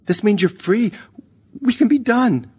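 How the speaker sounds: high, urgent pleading cry; desperate scream. A narrator's reading. Lisa to the ghost who haunts her, desperate high cry. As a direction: raise the pitch sharply and plead. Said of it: "She was screaming".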